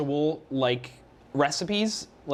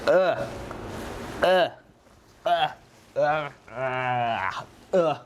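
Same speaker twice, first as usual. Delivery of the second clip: gagging ugh sounds; disgusted groan; slower than usual. Real, not acted. Tasting oil-soaked candy.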